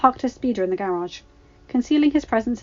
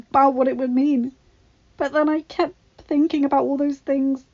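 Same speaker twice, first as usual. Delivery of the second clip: higher, breathy whispered start, tearful throughout; tearful telling; 6 semitones higher, 5 dB louder than usual. A narrator's reading. Padme tells her husband about the pregnancy, crying. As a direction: pour it out through tears. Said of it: "Padme sobbed".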